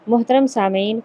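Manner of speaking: normal talking voice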